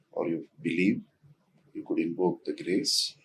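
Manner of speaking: soft, hushed grounding voice with long pauses; guided meditation. Real, not acted. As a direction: guide them gently inward, quietly, leaving space between phrases. Leads a short grounding.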